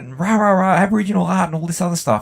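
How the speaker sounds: high, fast, smug imitation of a know-it-all; mocking a know-it-all. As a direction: rattle off her credentials in a high, mocking voice. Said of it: "And she goes".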